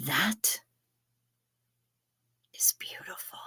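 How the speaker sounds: whispered, breathless delight; awed whisper. Real, not acted. Reacting to a viewer's phrase in a hushed awed whisper.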